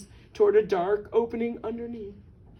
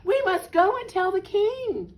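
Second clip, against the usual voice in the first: high, insistent hen voice; high urgent storybook cry; 9 semitones higher, 4 dB louder than usual. A narrator's reading. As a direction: announce it high, as a hen in a panic. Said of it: "cried Henny Penny".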